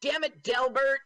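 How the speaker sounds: exasperated woman. Retold or imitated. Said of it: "she goes".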